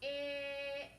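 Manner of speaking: "Ix" stretched as one slow phonics sound. Shown as a demonstration. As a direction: hold the vowel in "Ix" long and even. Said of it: "Say the sounds".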